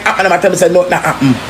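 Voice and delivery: usual speaking voice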